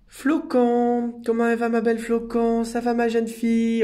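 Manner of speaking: high, sustained singsong hello; pet-greeting coo. Real, not acted. Carer saying hello to little Flocon, high doting singsong. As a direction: coo the name out long and high.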